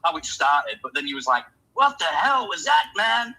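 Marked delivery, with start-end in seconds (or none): 1.7-3.3 loud, high, drawn-out mock confrontation; imitated angry outburst. Bandmate confronting the sound guy, imitated angry outburst. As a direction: confront him in a raised, slowed-down voice.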